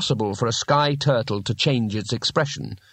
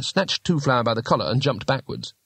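ordinary delivery, nothing marked

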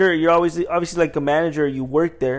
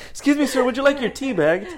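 higher, innocent customer-service voice; polite cashier; 9 semitones higher than usual. Acted. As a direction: ask with mock politeness. Acting polite prank on customer.

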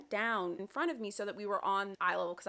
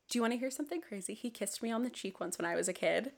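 ordinary talk

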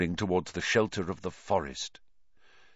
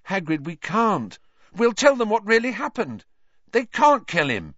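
put-on higher, louder desperate girl; desperate plea; 11 semitones higher, 5 dB louder than usual. A narrator's reading. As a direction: beg against the execution. Hermione protests desperately.

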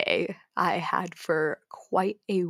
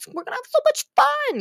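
much higher, squealing excitement; excited friends; 3 dB louder than usual. Retold or imitated. Teen friends planning the hooky day, giddy high squeal.

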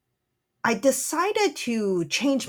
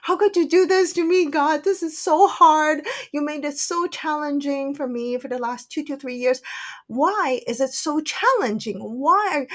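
cursing at God; 5 semitones higher than usual. Retold or imitated. Woman cries out to God in her despair, angry lament. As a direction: pour out the complaint, demanding why. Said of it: "I started to curse internally".

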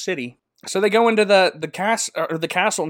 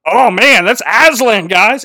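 loud, higher, thrilled gushing; starstruck mouse; 6 semitones higher, 10 dB louder, slower than usual. Retold or imitated. Reepicheep spots Aslan, loud excited gushing. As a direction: gasp it out like a fanboy. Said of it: "and he's like".

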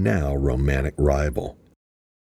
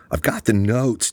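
higher, lightly raised call to the lab; announcing the find a bit higher; mild shout; 6 semitones higher than usual. A narrator's reading. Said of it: "Jack shouted".

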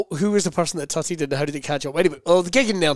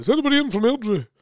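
higher-pitched impression of a compere calling out; mimicked opening question to an audience, pitched up; club compere; 8 semitones higher, faster than usual. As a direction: imitate an act working the crowd. Promoter's call to the audience imitated.

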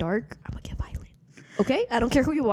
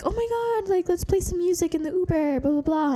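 much higher, silly excited voice; mocking party voice; 9 semitones higher than usual. Mocking the players wanting music in the Uber, high and giddy. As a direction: mimic them as giddy airheads.

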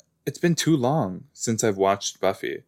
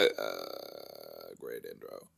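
deep slow silly voice; 17 dB quieter, much slower than usual. Pretend.

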